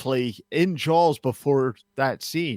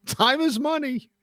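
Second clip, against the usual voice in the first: high, pushy hurry-up voice, laughing through; impatient dad; 7 semitones higher than usual. Retold or imitated. A father rushing the delivery room, higher and impatient. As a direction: rush the staff, higher and laughing. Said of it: "'Cause at that point".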